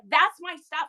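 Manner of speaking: loud, high retold protest; insistent retort. Seller claiming their pile from the wife, loud insistence. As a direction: stake the claim forcefully. Retold argument over belongings.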